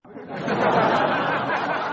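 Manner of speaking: big burst of laughter; loud laugh. Real, not acted. Host laughing at his own awkward shout-out, loud and high. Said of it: "That sounds weird, how I just did that".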